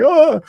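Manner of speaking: high, loud "oh!" of alarm; hammed-up high yelp of shock; mock shocked gasp. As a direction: act startled by what you read. Acts shock at seeing the letters.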